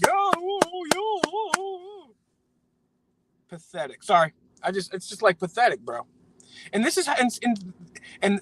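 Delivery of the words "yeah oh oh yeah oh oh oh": high-pitched mock oohs of approval, fading away; mock fawning cheer. Mocking conservatives cheering their politicians, high mock cheering. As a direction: squeal eager agreement way up high, then let it die off. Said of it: "the conservatives are like".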